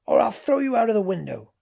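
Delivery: loud, high furious threat; yelling. A narrator's reading. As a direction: yell the threat at the machine. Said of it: "yelled Zenda".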